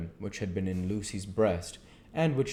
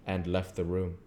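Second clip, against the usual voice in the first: plain reading voice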